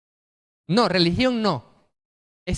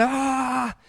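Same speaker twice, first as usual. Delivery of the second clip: mock battle shout; 6 semitones higher, 4 dB quieter than usual. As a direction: cry out high like the people at Jericho. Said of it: "ellos comenzaron a gritar". (grito fingido y agudo)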